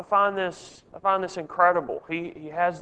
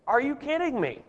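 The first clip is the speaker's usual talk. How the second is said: high-pitched incredulous question; disbelieving rhetorical question, pitched high; 8 semitones higher than usual. Real, not acted. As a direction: scoff in disbelief, pitch jumping up. Reacting to bystanders' bad advice.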